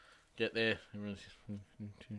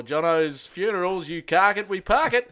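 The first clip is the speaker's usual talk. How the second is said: higher, louder, slow jingle patter; mock phone greeting; 8 semitones higher, 8 dB louder, slower than usual. Answering a mate's call as a fake funeral home, slow and cheesy. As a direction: sell it like a tacky funeral ad.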